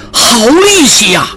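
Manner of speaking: high cry of admiration at the end; amazed exclamation. A narrator's reading. Street crowd marvelling at a strong man's strength, amazed. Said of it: "众人纷纷惊叹着".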